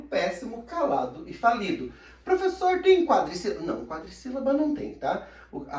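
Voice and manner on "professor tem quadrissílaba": high, loud pupil voice; student asking. Acted. Student asking the teacher a question, high raised voice. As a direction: pipe up like a pupil in class.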